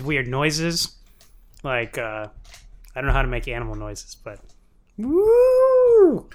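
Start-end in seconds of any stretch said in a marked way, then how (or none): 5.0-6.3 high, loud trumpeting "woo"; elephant impression. Shown as a demonstration. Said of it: "That's my impression of an elephant".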